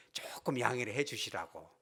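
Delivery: breathless hushed aside; a whispered word of apology; whispered request. Retold or imitated. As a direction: whisper the elder's polite plea.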